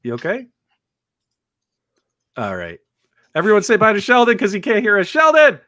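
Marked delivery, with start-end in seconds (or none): none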